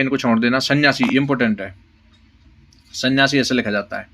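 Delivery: ordinary delivery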